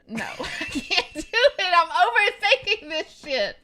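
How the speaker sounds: high, loud protest breaking into laughter; flustered laughing protest. Real, not acted. Reader put under pressure to act, flustered laughing protest. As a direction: laugh helplessly while backing out.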